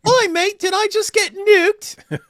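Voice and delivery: high, loud mock British accent, slow "nuked"; British girl accent. Mock British girl asking if she got nuked, high accent. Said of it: "British girl".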